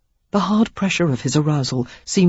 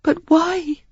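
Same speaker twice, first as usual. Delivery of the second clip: pleading woman; 13 semitones higher than usual. Acted. Sarah pleading.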